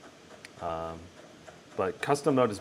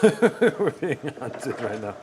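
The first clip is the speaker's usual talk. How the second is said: laughing; 3 semitones higher, 5 dB louder, much slower than usual. Real, not acted. Laughing while being chased.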